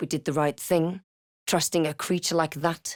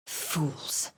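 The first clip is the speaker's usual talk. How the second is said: much softer scornful whisper, lower; contemptuous whisper; 3 semitones lower, 8 dB quieter than usual. Acted. Sneering at the dead, soft scornful whisper. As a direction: breathe out your scorn.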